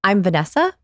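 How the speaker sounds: "Vanessa" rising at the end like a question; question inflection. Shown as a demonstration. Coach showing the wrong introduction, questioning rise. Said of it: "here's the difference".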